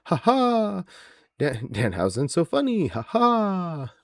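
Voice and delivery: very high fake laugh between mock praise; Jimmy Carr. Wrestling fan mocking Danhausen's admirers, fake Jimmy Carr laugh. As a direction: squeal with put-on amusement. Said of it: "like, we're all Jimmy Carr".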